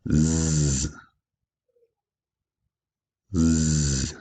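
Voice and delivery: "z" as a long voiced buzz; isolated z sound. Shown as a demonstration. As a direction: hold the buzzing "z", not the letter name. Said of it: "The second sound is".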